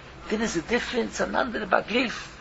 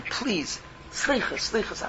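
soft plea; 7 dB quieter, slower than usual. Retold or imitated.

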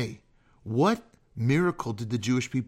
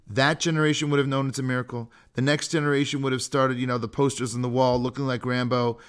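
normal talking voice

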